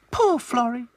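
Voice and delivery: high sympathetic doll voice; Lucy pitying the forgetful cook; Lucy's sympathy. A narrator's reading. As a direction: feel sorry for her. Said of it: "said Lucy".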